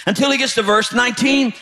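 usual speaking voice

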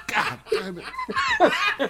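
drawn-out high groan of disbelief. Retold or imitated. Coworker retelling the bank call, slow high groan. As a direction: stretch it slow and high, half laughing. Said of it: "I said".

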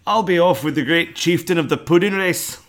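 Shrek voice. Acted. Ending the Shrek bit with the poem in a Scottish accent. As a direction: boom out the Burns line as Shrek. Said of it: "aggressive Shrek".

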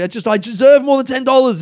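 high, loud, aggrieved protest; worked-up retold grievance; indignant complaint. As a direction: argue it like a wronged customer. Retold complaint call, arguing for compensation.

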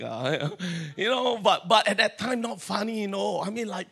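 usual speaking voice

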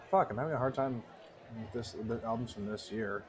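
usual speaking voice

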